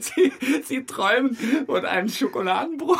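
teasing words through high laughter; laughing. Real, not acted. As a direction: tease him while laughing.

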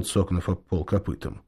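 ordinary delivery

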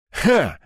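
short high snort of amusement; scoffing "ha". A narrator's reading. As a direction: let out a single scoffing ha. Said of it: "хмыкнул Седой". (короткий насмешливый хмык)

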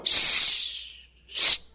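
a whispered shushing; hushing. A narrator's reading. Peacock hushing the noisy farm animals, whispered. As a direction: hush the crowd down. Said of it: "faisait le paon".

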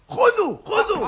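pitched high, eager offering; high excited call. Acted. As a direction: toss it out gleefully. Tossing food to others.

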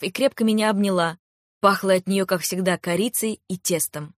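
usual speaking voice

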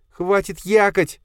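high, slow stern female voice; stern woman. Acted. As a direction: cut him off sharply. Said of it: "остановила его Софья". (Софья резко обрывает инженера)

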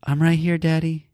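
a hint of a small child piping up from hiding; small son answering. Father voicing his hidden son calling out to him, lightly voiced small boy. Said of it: "and I finally hear".

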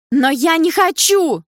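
high, loud, drawn-out protest; desperate high-pitched wail; panicked cry. A narrator's reading. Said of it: "выкрикнула в панике Карина".

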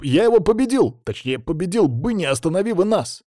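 high, whiny outburst; indignant protest. A narrator's reading. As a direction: protest heatedly at being robbed. Youth protests interrupted duel.

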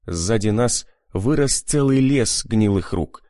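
ordinary delivery, nothing marked

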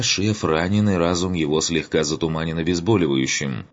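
ordinary talk, nothing marked

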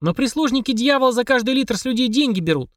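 put-on high, earnest child voice; a little girl complaining; young girl voice. Girl character voice in story.

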